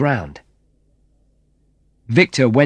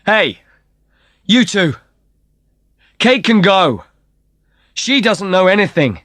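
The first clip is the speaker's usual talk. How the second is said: high, loud plea; shouting; 10 semitones higher, 4 dB louder than usual. Acted. [name] shouts to the brothers to let Kate go, high and loud. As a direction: call across to the brothers. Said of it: "I shouted to the brothers".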